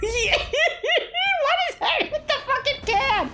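high, slow squeal through laughter; helpless high-pitched disbelief; incredulous laughing. Real, not acted. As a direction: barely get the question out laughing. Cracking up at film scene.

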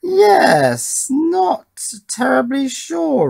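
high, drawn-out unsure voice; high-pitched doubt. Real, not acted. Tester doubting his strained high tone, high and unsure.

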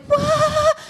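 high-pitched disbelieving what; a high shocked yelp of disbelief. Retold or imitated. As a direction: squeal out in mock shock. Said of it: "I was like".

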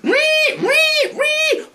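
very high robotic ree ree ree; robot noise. Pretend. Uncle showing off his robot voice, very high and mechanical. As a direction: squeal it like a robot. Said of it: "Proper robotic like Robocop's".

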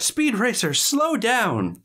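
high-pitched calling-out; teacher yelling out. Acted. Teacher to the boy already leaving, high mock yell. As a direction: call after a runaway pupil. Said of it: "the teacher has to yell out".

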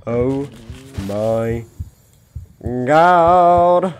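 startled oh my God; surprised exclaim. Real, not acted. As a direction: burst out.

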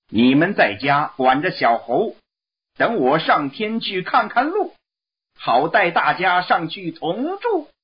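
high monkey voice, commanding and cheerful; Monkey King giving orders. Acted. Monkey King instructing his four generals before leaving, high commanding voice. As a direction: boss your generals about in a high voice.

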